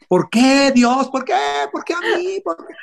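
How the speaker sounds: hammed-up, very high wail to the heavens; mock lament. Voice actor mocking a whiny famous man's complaint, hammed-up wail. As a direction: overplay the self-pitying star crying to God. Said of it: "imagínate mi caso de que yo fuera por la vida diciendo".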